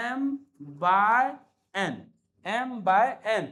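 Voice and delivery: M, by, N, each word alone with pauses; slow spelled out. Shown as a demonstration. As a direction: say "M by N" word by word, then slowly. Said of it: "देखो M by N यहीं पे होता है". ("M by N" को धीरे-धीरे, हर शब्द रुककर)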